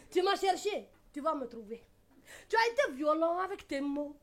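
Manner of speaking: ordinary talk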